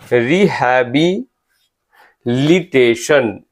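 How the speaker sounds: Rehabilitation very slow, with a break before लिटेशन; syllable by syllable. Shown as a demonstration. Teacher helping students memorise a spelling, rehabilitation very slowly in parts. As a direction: draw out rehabilitation in separate parts.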